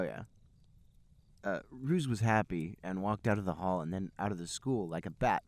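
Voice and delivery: ordinary talk, nothing marked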